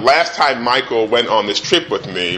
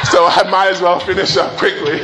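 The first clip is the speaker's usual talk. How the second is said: laughing; 7 semitones higher than usual. Real, not acted.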